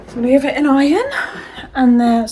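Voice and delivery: breathless, puffing between words; out of breath. Real, not acted. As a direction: talk while catching your breath. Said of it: "sorry, I'm out of breath".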